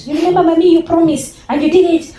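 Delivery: put-on high, loud complaining child; child reproaching mummy for a broken promise, high and loud; accusing child. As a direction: whine it at mummy like a wronged kid.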